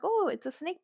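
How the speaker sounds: playful high quip about the drink. Retold or imitated. Retold playful answer.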